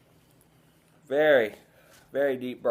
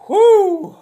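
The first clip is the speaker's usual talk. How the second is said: loud high whew of pain from the burn; 13 dB louder than usual. Real, not acted. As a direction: blow out a sharp, loud whew. Reacting to hot pepper burn.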